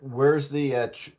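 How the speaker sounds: usual speaking voice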